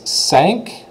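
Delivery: "sank" like the ship sank; French five as "sank"; French cinq. Shown as a demonstration. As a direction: say cinq as English "sank", rising. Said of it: "like the ship sank".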